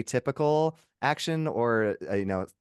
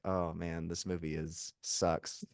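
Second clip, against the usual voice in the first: muttering disappointed; 6 semitones lower, 11 dB quieter, slower than usual. Retold or imitated. Viewer disappointed by movie.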